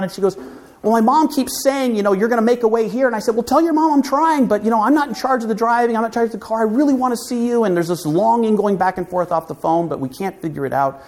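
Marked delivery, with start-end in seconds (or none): 0.8-3.1 put-on raised, fast girlish voice, lightly done; girlfriend's voice, a bit higher. Girlfriend quoting her mom. 3.4-7.6 higher, fast pleading voice; longing plea. Retold or imitated. Longing phone call with girlfriend.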